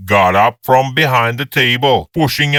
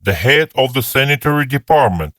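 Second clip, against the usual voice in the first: ordinary delivery, nothing marked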